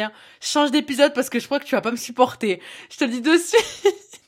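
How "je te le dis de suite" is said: Toulouse accent, laughing a little; southern French accent, playful. Shown as a demonstration. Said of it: "j'ai sorti l'accent du Sud".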